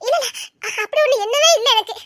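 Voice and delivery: ordinary talk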